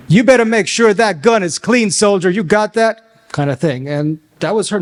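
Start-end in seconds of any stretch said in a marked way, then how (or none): 0.1-3.3 higher and louder, barking orders at a soldier; drill-sergeant command, the last words slowed; military commander voice. Retold or imitated. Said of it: "speaking in a normal tone of voice that she learned in the military".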